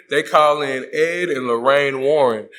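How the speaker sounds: ordinary talk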